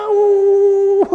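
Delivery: high strangled yelp; sudden loud howl; mock anguished cry. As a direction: cry out in hammed-up rage. Acted raging parent.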